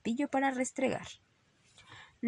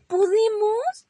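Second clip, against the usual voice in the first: high, loud, drawn-out question; eager young rabbits; 14 semitones higher, 10 dB louder, much slower than usual. Acted. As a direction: plead hopefully like excited children. Little rabbits asking together eagerly.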